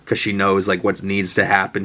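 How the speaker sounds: ordinary talk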